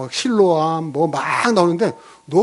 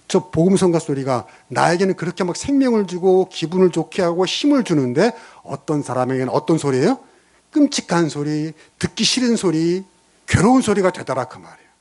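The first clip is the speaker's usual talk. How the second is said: usual speaking voice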